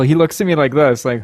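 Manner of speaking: ordinary delivery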